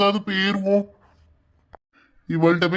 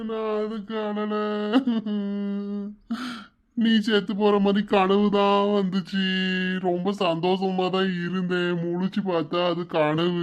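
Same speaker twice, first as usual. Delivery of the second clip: crying; 4 dB quieter than usual. Acted. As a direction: sob quietly between the phrases. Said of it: "ஏன் இப்படி அழுதுட்டு இருக்கீங்க". (அழுகையோடு மெதுவாக)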